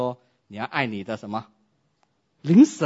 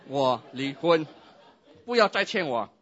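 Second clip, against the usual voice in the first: insistent demand; 3 semitones higher, 5 dB louder than usual. Acted. Spouse to pastor, insisting on divorce, loud. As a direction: hammer home the divorce.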